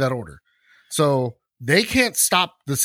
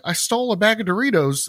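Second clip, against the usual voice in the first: mock high defendant; 7 semitones higher than usual.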